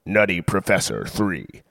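smooth, measured movie trailer read; announcer voice selling a comedy sequel; trailer voiceover. Acted. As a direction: sell it like a big studio trailer.

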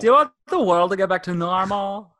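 overdone repeat of an inquisitive cartoon voice; random South Park shouter. Repeating the question on request, hammed-up South Park extra. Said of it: "sound like the random guy in South Park".

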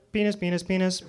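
quick repeated video-game blips, lightly done; hint of a cartoony game voice; Super Mario voice. Shown as a demonstration. Comic demonstrating Super Mario for the crowd, light video-game voice. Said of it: "penis in Super Mario Brothers".

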